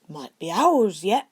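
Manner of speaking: slow, loud, gruff big-man voice; gruff Hagrid. A narrator's reading. Hagrid to the boys at the egg, gruff and slow. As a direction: drag it out gruff and heavy. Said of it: "Hagrid said gruffly".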